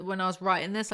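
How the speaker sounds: normal talking voice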